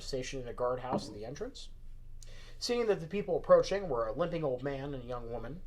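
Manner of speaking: ordinary delivery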